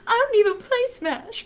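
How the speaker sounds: quoting the clip's opening, high and excited; high exclaim. Retold or imitated. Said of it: "clip starts with me going".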